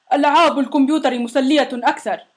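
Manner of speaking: mild shout from a monster; calling out. A narrator's reading. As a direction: pipe up with a half-hearted shout. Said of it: "فصاح". (بصياح خفيف)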